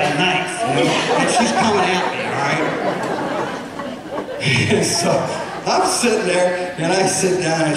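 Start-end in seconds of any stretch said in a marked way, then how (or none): 3.2-4.6 laugh. Real, not acted. Laughs at the story.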